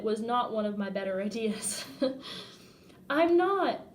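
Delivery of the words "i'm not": high, loud cry of denial; high, loud panicked "I'm not."; desperate denial. Retold or imitated. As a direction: plead it with panic.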